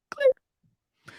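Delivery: exasperated high squeak; squealed clear. Real, not acted. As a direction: shoot way up into a strained squeal. Frustrated outburst on show promise.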